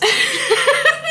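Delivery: high, loud burst of giggling; high giggle. Real, not acted. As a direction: break into a bright giggle.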